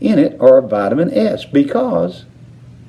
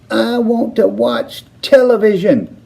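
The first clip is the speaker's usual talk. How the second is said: high tiny voice in a furious tantrum; the tiny boy screaming for his TV; tantrum squeak; 12 semitones higher than usual. A narrator's reading. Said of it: "he squeaked".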